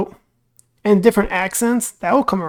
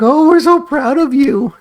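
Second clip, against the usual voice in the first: louder, slow, overdone "so proud" gush; mock fake pride; 5 semitones higher, 6 dB louder, slower than usual. Relatives pretending to praise Timmy, hammed-up mock pride. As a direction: ham up forced family praise. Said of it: "play along and be like".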